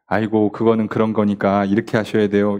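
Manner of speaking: low, level, soothing advice; calm wise person. Acted. Wise person advising instead of scolding, calm and low. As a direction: explain patiently in a low, even pitch. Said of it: "그거보다 지혜로운 사람이".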